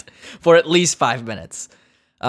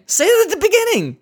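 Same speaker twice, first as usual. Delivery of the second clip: high, pleading rant; exasperated rant. Acted. Comedian ranting at phone menu, high exasperated plea. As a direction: beg them to get to the point.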